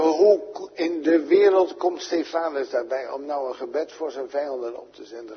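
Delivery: usual speaking voice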